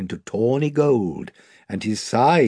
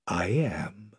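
hushed lion whisper; 5 semitones lower, 9 dB quieter than usual. A narrator's reading. Aslan answers Edmund, whispered. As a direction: breathe it softly.

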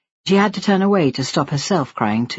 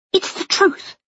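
very high, tearful insistence; upset daughter standing her ground; hurt defiance. Acted. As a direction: insist on it, pitched right up. Daughter's quarrel with her father.

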